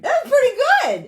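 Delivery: very high, loud burst of praise; amazed praise. Real, not acted. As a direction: squeal it in delighted surprise. Amazed by his tongue twister success.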